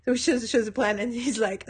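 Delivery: ordinary talk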